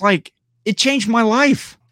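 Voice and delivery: gushing. Real, not acted. Gushing about a phone filter.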